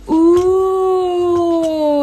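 gasp, then long high "ooh"; delighted gasp and coo. Real, not acted. Admiring the new binder, delighted high coo.

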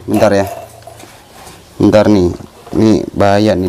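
normal talking voice

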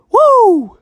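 high, loud woo; excited whoop. Real, not acted. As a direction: whoop with delight. Cheering a trick play.

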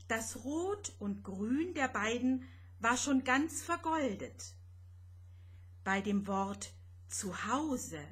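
ordinary delivery, nothing marked

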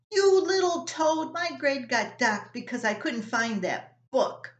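high, loud furious yell, easing off; teen lifting his little brother off the floor and yelling; furious big brother. Acted. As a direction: roar it at him, then spit out the grievance. Rob grabs Russell by the collar.

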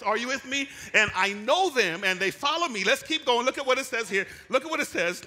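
high, loud whooping sermon climb; full-force preaching. Real, not acted. Preacher rousing the congregation, loud and high. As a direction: lift the whole room with it.

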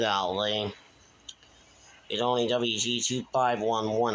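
normal talking voice

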